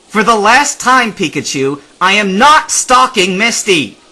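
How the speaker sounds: loud, high exasperated protest; angry. A narrator's reading. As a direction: snap back at Pikachu. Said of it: "Ash said angrily".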